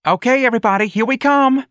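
put-on very high, loud girlish announcement; high, projected, excited entrance call. A narrator's reading. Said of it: "Darlene called".

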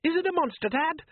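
put-on high frightened boy's voice; a scared son pleading. A narrator's reading. Son Les asking his father in fear, high frightened boy voice.

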